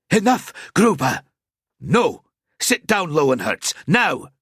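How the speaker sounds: high, loud barked orders; angry commands. A narrator's reading. Lowenhertz orders Gruber to sit, loud and angry. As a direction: shout him down.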